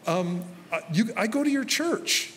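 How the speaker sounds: high, bright mock answer; eager churchgoer. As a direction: play an eager fan of the pastor. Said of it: "And they go".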